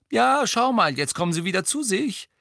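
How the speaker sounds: high, bright-eyed call; upbeat pointing-out. A narrator's reading. George showing Hermine they are coming round, upbeat. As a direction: draw her attention, loud and high.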